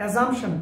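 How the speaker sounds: assumption said as ae-ZUMP-shun; wrong pronunciation. Shown as a demonstration. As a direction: put a z in assumption. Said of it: "Assumption ভুল উচ্চারণ". (assumption ভুলভাবে জ দিয়ে)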